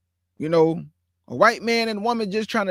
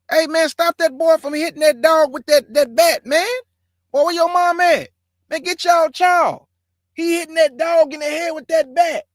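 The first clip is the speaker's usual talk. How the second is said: put-on higher, louder yell at a kid to stop; alarmed stranger hollering for the mom; outraged bystander shout; 8 semitones higher, 5 dB louder than usual. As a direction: shout across the yard to stop him. Bystander yelling at boy hitting dog.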